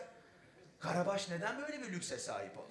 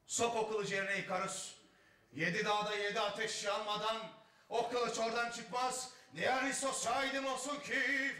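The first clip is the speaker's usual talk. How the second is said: grand tragic actor, building higher and louder; classical stage declamation; 4 semitones higher, 3 dB louder than usual. Acted. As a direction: declaim an oath from ancient Greek drama. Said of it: "repertuarlarda böyle geçiliyor".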